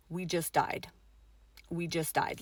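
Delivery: soft, low, stunned statement; subdued, sinking realization; hushed dread. Retold or imitated. Recalls dreadful feeling of death.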